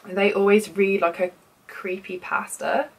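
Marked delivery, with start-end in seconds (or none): 1.7-2.9 creepypasta in an American accent; slow, imitated American accent on creepypasta. Shown as a demonstration. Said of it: "so much better in an American accent".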